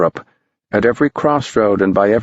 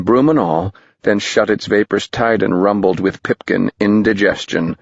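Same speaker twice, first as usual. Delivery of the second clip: ordinary delivery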